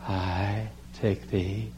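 very slow, soft, swooning vow; dreamy bride. Retold or imitated. Bride gazing at the groom while saying her vow, slow and soft. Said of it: "and she said".

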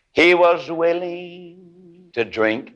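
slow, high, chanted pulpit cadence; preacher whooping the close over the organ; intoned preaching. Acted. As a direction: stretch each phrase out in a sung-preach whoop. Intoned closing over music.

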